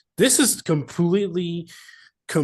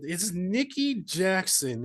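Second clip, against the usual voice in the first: ordinary delivery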